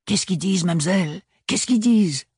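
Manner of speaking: high, urgent pleading; burning to know; eager curiosity. Acted. Pistache curieuse demande.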